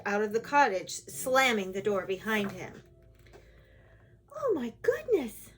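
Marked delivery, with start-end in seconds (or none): 4.3-5.5 high-pitched storybook dismay; shocked cry. A narrator's reading. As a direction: exclaim it high, taken aback.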